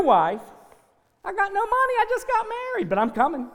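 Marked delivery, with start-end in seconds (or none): 1.2-3.5 eager excitement. Retold or imitated. Retelling his own eager self.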